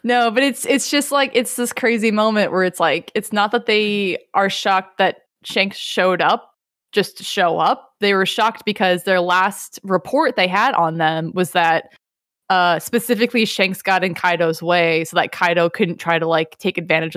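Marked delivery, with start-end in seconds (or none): none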